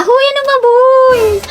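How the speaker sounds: high, girlish put-on squeal; babyish cutesy. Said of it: "Ang pabebe".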